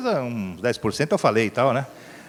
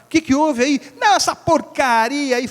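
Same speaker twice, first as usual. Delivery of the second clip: roadside dialogue; 10 semitones higher than usual. Retold or imitated.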